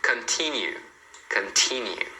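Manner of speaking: continue with a clear stressed T; T pronounced. Shown as a demonstration. Teacher models an exception, the T in continue pronounced. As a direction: sound the T in continue, stressing that syllable. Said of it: "você tem que falar nesses casos".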